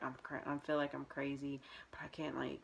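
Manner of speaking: hushed, breathy inner voice; under-the-breath worried whisper; whispering to herself. Retold or imitated. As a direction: whisper it like a secret thought.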